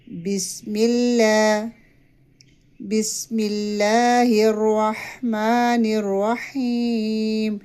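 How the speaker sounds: slow, drawn-out recitation to repeat; careful tajweed chant; recite for practice. Shown as a demonstration. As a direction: model the verse for students. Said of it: "practice کریں ساتھ".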